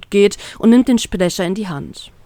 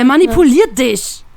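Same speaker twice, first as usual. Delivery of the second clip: louder, higher, upset and stunned; upset outcry; 5 semitones higher, 4 dB louder than usual. Acted. Ace interrupting the girl, upset and louder. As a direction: cut in, outraged. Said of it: "ruft Ace aufgebracht".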